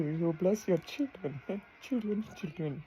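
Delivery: real tears, voice fading to a whisper; choked tears. Real, not acted. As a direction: let the voice break and fade away on the last words. Breaking down mid-sentence.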